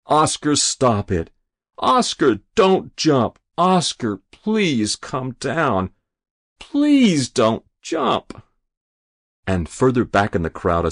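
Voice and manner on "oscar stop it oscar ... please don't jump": desperate pleading. Acted. Rabbi on his knees begging the boy not to jump, desperate pleading. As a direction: beg him not to jump, voice straining higher. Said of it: "he pleaded".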